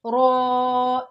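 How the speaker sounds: "रॉ" said full and heavy, loud; heavy raw. Shown as a demonstration. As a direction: make "रॉ" thick and full. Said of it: "यहाँ पे पुर पढ़ना है". ("रॉ" पुर करके)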